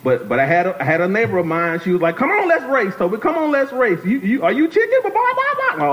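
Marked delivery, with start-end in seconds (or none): 2.2-5.0 put-on high, goading kid challenge; taunting dare. Neighbor challenges Toby to a bike race, taunting. 5.0-5.8 very high-pitched bawk-bawk taunt; mock chicken clucking. Neighbor mocks Toby as chicken, clucking.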